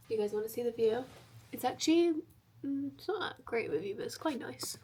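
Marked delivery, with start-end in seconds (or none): 1.5-4.8 whispered aside. Real, not acted. Showing viewers the view, whispering. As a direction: drop to a whisper.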